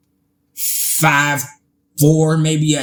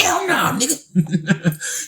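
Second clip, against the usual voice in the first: high, squealed mock refusal; defiant retelling; 9 semitones higher, 4 dB quieter, much slower than usual. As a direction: refuse in an imitated high-pitched voice. Retold refusal repeated.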